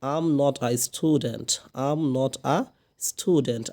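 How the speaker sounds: very slow, each word spelled out for learners; slow model sentence. Shown as a demonstration. Teacher slowly models the negative for students. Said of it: "I am পড়লাম অথবা am".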